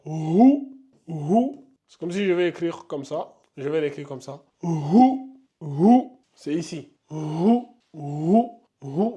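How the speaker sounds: "Rou" pressed hard from the throat; pressed letter demo. Shown as a demonstration. Teacher tells students to press the sound, emphatic "Rou". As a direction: lean on "Rou" heavily. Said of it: "Vous appuyez bien".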